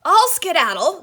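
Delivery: loud, high pained cry; a beaten wolf yelping in defeat; anguished wolf. A narrator's reading. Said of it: "the wolf said in anguish".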